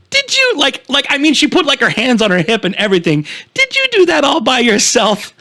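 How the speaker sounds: put-on very high, sing-song baby talk; condescending lady. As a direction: coo it like praising a toddler. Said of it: "That was the tone".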